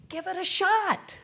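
slow high-pitched urging; soaring high cry. Real, not acted. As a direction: lift it way up to spur them on. Rallying the congregation.

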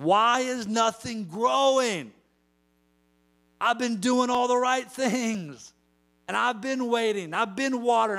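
high, pleading complaint of a farmer; frustrated farmer. Retold or imitated. Farmer complains to the ground, high frustrated whine. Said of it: "looking down at the ground saying".

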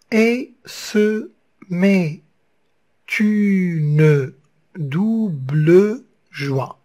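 very slow, every syllable separated; slow syllabic reading of the line; counting syllables. Shown as a demonstration. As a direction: scan it slowly to count the beats. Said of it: "Huit syllabes".

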